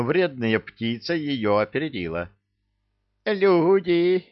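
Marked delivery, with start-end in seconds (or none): 3.2-4.3 high, loud ecstatic shout; rapturous cry. A narrator's reading. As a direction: cry out in delight. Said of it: "упоенно воскликнул попугай".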